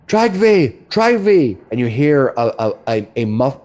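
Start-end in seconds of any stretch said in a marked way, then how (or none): 0.1-1.6 high, loud shouted call. Acted. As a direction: call the name out across the keep. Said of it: "Vonda calls out".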